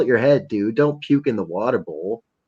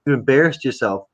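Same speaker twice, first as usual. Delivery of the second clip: higher, teasing stern-parent tone; a hint of a stern father teasing; mock scolding friend; 7 semitones higher than usual. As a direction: scold him like a disappointed dad. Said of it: "he's like".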